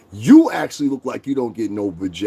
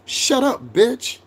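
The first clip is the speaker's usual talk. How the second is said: angry dismissal; 10 semitones higher, much slower than usual. Acted. Telling off chat trolls.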